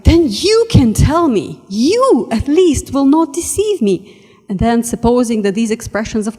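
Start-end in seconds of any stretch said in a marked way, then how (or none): 0.0-4.2 higher and louder, slow and urgent; crying out. Acted. Lewis crying out to MacDonald, high loud eager plea. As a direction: plead eagerly with your hero. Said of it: "I cried".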